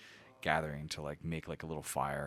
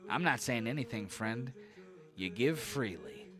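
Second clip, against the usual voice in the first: higher, wheedling character voice; slyly innocent gnome; 6 semitones higher, slower than usual. Acted. Gnome denies asking for payment, slyly innocent. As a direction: feign innocence, stretching the last phrase.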